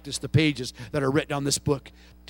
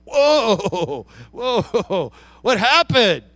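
louder, taken-aback "whoa"s, then easing down; startled backing off; 3 semitones higher, 5 dB louder, much slower than usual. Retold or imitated. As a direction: back off like you've been snapped at.

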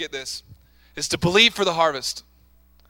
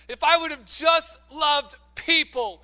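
screaming it out; 7 semitones higher, slower than usual. Retold or imitated. Grieving mother crying out to the congregation, raised voice. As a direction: cry it out to the church like the grieving mother. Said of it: "she would be screaming it out".

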